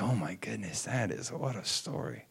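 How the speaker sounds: quietly stunned, trailing down low; hushed amazement. Retold or imitated. Passenger hearing the son's whole story, hushed amazement. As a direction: react softly, deeply moved.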